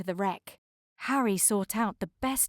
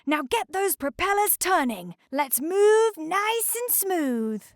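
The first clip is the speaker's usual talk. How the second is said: icebreaker character voice; 9 semitones higher, 5 dB louder than usual. A narrator's reading. Snow commanding.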